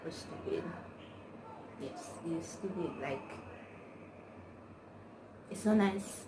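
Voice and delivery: ordinary delivery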